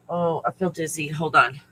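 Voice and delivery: ordinary talk, nothing marked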